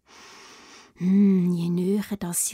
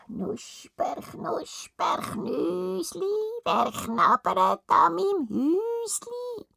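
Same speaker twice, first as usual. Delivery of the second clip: high, slow, eerie witch croon; sing-song it like a lurking witch; 9 semitones higher, 3 dB quieter, much slower than usual. Acted. The witch calling from her gingerbread house. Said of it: "hören sie eine Stimme".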